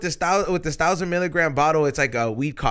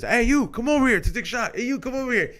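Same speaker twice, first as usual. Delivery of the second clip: calling out; 10 semitones higher than usual. Retold or imitated. Calling friends over for shots.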